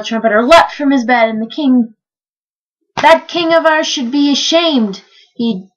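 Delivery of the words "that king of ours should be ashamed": indignant. A narrator's reading. Trumpeter indignant at the king.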